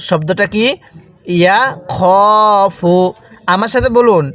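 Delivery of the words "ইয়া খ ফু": "ইয়া খ ফু" with a long held খ; drawn-out vowel. Shown as a demonstration. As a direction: stretch the খ in "ইয়া খ ফু". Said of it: "এরকমভাবে শিখব".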